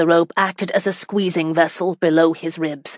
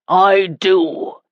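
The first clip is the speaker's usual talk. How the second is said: louder, blunt answer; bellowing; 3 dB louder than usual. A narrator's reading. Husband bellowing at his defiant wife. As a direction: slam the answer down. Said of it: "Geoffrey bellowed".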